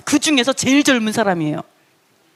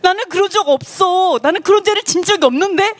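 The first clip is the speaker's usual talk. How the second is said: higher-pitched mock protest; indignant denial; 6 semitones higher than usual. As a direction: deny it as if wrongly accused. Acting Job's indignant denial. (억울하다는 듯 높게 부인하는 흉내)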